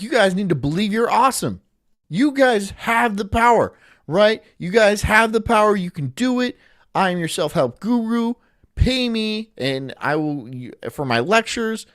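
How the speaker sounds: a hint of a Tony Robbins seminar pitch; Tony Robbins impression. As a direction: pump up the crowd like a motivational speaker. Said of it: "I could be like Tony Robbins".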